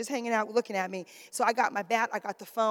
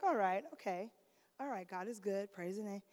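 soft, low, nervous muttering to herself; quiet nervous reassurance; hushed self-talk; 4 semitones lower, 13 dB quieter than usual. Retold or imitated. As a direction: talk yourself calm under your breath.

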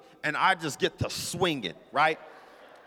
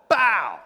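loud, explosive "bow!"; vocal sound effect, sudden and loud; imitated slap impact; 2 semitones higher, 7 dB louder than usual.

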